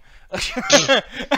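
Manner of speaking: high, loud rising laugh. Real, not acted. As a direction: burst out laughing at the pun. Laughing at the auto zone pun.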